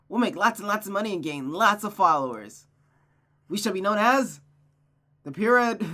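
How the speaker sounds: high, loud, eager scheming; excited character. Acted. Friend planning a travelling show together, excited.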